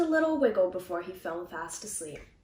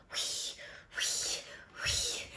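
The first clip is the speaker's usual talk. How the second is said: whistling snore; 11 dB quieter, much slower than usual. A narrator's reading. Snoring sound in story.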